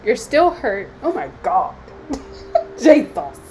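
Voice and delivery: tearful, breaking into sobs; real crying. Real, not acted. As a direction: let the real tears take over mid-reading. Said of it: "oh my God, I'm about to cry".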